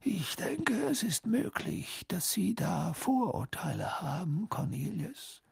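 soft, high, half-whispered rebuke; serene, hushed old wizard; unruffled. A narrator's reading. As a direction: reproach gently in a slow, airy voice. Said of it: "sagte Dumbledore weiterhin gelassen".

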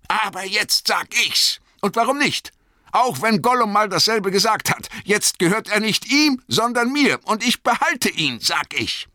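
high, loud, defiant hobbit; Bilbo, trotzig. Acted. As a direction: insist possessively in a high-pitched voice. (trotzig und zornig, hohe Stimme)

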